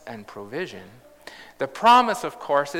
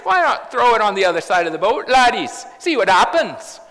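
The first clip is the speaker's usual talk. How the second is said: put-on Irish accent; 5 semitones higher, 7 dB louder, faster than usual. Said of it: "a wee bit of Irish accent in that".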